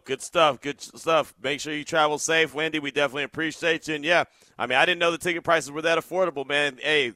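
ordinary talk, nothing marked

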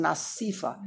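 "Asifa" with an ee in the middle; common pronunciation. Shown as a demonstration. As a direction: say "Asifa" as a-SEE-fa. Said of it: "There's no such word".

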